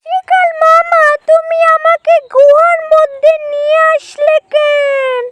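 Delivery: high bunny voice, anxious question; alarmed little rabbit. Acted. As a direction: play a rabbit questioning its captor, pitched high.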